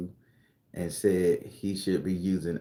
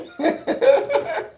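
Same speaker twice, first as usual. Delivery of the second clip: shrieking laugh; high loud laugh; 5 dB louder than usual. Real, not acted. After snake rant.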